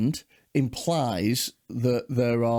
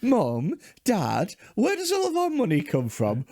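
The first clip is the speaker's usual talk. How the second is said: lightly voiced child, higher on the question; curious child; 6 semitones higher than usual. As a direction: play a kid questioning the parents. Acts out descendant asking parents.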